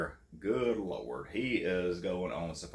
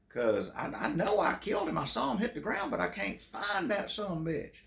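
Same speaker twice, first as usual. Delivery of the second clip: put-on Southern plantation-owner accent; 12 semitones higher than usual. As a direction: insist in a big Foghorn-style Southern accent. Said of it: "sounded like he owned a plantation".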